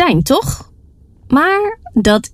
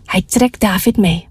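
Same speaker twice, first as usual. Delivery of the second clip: usual speaking voice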